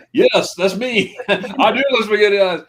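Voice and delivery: slow, loud, high, laughing yes; laughing reply. Retold or imitated. Recognized in a Schweinfurt hotel, laughing and loud. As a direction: laugh through the admission, slowly. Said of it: "I'm like".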